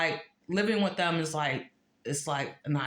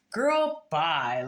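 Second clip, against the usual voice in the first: put-on dismissive girl bye; 3 dB louder than usual. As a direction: wave her off with attitude. Dismissing a jealous person.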